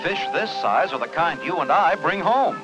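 ordinary talk

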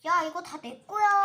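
loud, bossy claim; possessive shout. Pretend. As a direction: shout to keep every toy. 인형끼리 장난감 다툼. (다 내 거라며 크게 소리침)

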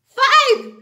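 high, loud answer; excited answer. Acted. Teacher calling out the answer, high and loud. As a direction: belt it out for the kids.

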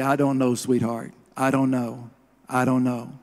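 put-on soft, hushed reassurance to a grieving child; tender comfort. Preacher at the funeral, softly comforting the orphaned girl. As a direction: gently soothe a crying little girl.

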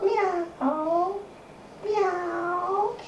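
high rising meow; cat meow. Retold or imitated. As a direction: meow like a kitten. Parent echoes the cat sound.

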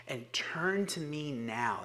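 slow urgent whisper; hushed urging. Acted. Jesus urges the lukewarm church in a slow whisper. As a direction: breathe the call to turn now.

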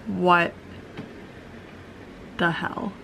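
ordinary talk

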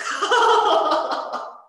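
high, loud burst of laughter; long, high cackling laugh; big belly laugh. Real, not acted. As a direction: crack up at a comment. Cracks up after reading the comment.

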